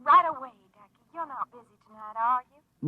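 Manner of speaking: low, hushed seductive purr; sultry flirt. Acted. Lorene luring Frank to come over, low seductive purr. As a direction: lure him with a low, lonesome coo.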